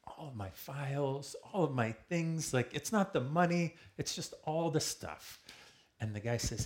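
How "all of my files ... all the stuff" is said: high, drawn-out lament over lost things; retold dismay. Speaker recounts losing all the files, dismayed. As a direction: wail it in mock despair, raised and stretched.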